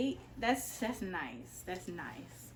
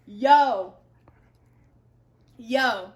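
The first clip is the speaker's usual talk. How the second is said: loud stunned yo; can't find other words; excited yo; 13 dB louder, much slower than usual. Real, not acted. Reacting to the palette, loud stunned yo. Overwhelmed, exclaiming.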